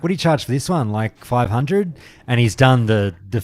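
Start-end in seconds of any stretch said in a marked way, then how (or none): 0.0-1.9 lowball him with a grin; cheeky customer guessing a price; cheeky chatter. Retold or imitated. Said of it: "cheeky fuckwit and just be chatty".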